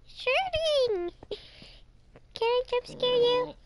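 high, playful animatronic voice; Mangle voice. Pretend. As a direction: play Mangle answering the phone. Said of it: "Let me just give her a little call".

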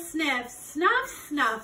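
ordinary delivery

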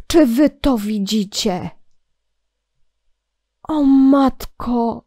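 slow, breathless astonishment; gasp, then marvel; gasping amazement. Real, not acted. Gasps at the build.

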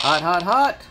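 loud, high "Hot, hot, hot"; excited "hot". Real, not acted. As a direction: call out "Hot, hot, hot" like a find. Geiger counter finds radiation.

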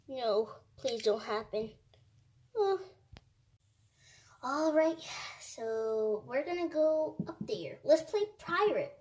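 usual speaking voice

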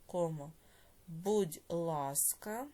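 slow, drawn-out dictation with long pauses. Shown as a demonstration. Teacher dictating a note to pupils, slow measured dictation. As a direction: dictate word by word, stretching syllables for writers.

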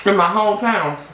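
eating wings between words; talking while chewing. Real, not acted. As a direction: mouth partly full of food. Seen 0: Holds wing pieces at mouth height, lips pursed as if chewing between phrases.

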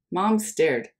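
usual speaking voice

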